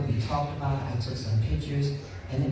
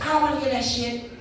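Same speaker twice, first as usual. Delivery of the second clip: imitating Carol brushing off the lecture, higher and quick; dismissive retort; 7 semitones higher than usual. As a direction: wave off the diabetes talk, pitched up and fast. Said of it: "She said".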